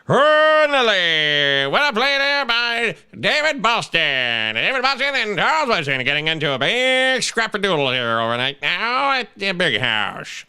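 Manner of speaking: slow, drawn-out classic play-by-play voice; old-school college football announcer, savoring it; Keith Jackson impression. Host doing Keith Jackson calling a Michigan game. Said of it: "saying it like Keith Jackson".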